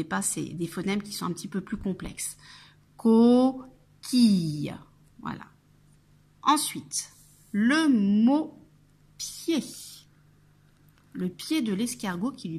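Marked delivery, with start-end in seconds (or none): 3.0-4.7 "Coquille" loud, in two long separated syllables; syllables drawn out. Shown as a demonstration. Teacher recapping the whole word, "Coquille" sounded out slowly. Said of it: "on va entendre I". 7.5-9.6 "le mot pied" word by word, "pied" higher; careful dictation. Shown as a demonstration. Teacher announcing the next word, "pied" carefully dictated. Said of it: "il va falloir bien écouter".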